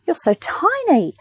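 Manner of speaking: high, sing-song nurse quote; nurse's voice. Retold or imitated. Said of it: "she goes".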